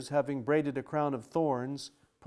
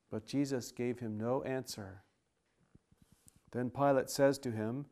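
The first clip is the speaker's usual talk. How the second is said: ordinary delivery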